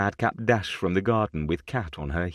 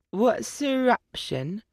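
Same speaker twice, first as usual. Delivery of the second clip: put-on high, slow puzzled child; a little girl stumbling on a big word; small girl voice; slower than usual. A narrator's reading. Little girl asks about a word, slow puzzled child.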